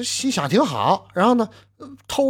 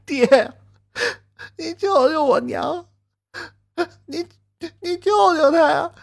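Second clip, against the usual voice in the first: much higher, pleading sobbing voice; a child begging his father through tears; child crying; 13 semitones higher than usual. Acted. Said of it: "张双喜儿也哭". (孩子哭着求爹救娘)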